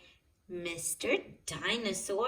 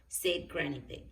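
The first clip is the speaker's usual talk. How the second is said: ordinary delivery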